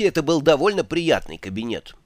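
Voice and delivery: usual speaking voice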